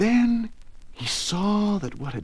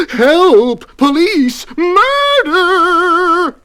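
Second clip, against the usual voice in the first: loud, high cries for help; terrified scream; 13 dB louder, much slower than usual. A narrator's reading. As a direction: scream for help in melodramatic panic. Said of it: "he screamed".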